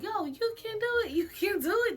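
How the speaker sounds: high, lightly voiced kids cheering. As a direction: imitate kids egging her on. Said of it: "the kids were still like".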